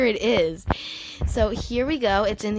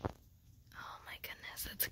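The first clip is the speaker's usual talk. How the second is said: hushed, slower whisper of amazement; awed whisper; 24 dB quieter, slower than usual. Real, not acted. Whispering in awe at the full-grown pet rock. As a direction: breathe it out, stunned.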